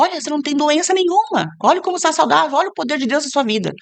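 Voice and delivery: high, insistent encouragement; animated, high-pitched exhortation; retold pep talk. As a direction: act out urging a husband to look.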